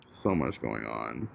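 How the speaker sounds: barely audible, low and slow; quiet. Real, not acted. Exhausted aside, very quiet.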